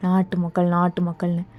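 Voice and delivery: a contemptuous husband scoffs; scornful mutter. Acted. Husband scoffs at her words.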